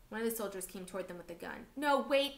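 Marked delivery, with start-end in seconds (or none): 1.8-2.4 loud pleading cry. Acted. Mother pleading with the soldier, crying out loud. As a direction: stop the soldier with a desperate cry. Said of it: "Joseph's mother cried".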